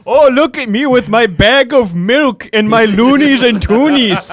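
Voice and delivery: high mock Canadian accent; hammy Canadian voice, up high. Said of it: "do our best Canadian accent".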